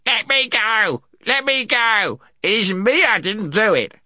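very high, put-on creature voice; high-pitched frightened protest; squealing gnome. A narrator's reading. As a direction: squeal in panic like a captured gnome. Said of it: "squealed the Earthman".

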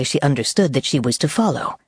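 usual speaking voice

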